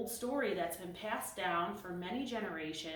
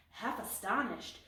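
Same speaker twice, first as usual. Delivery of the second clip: usual speaking voice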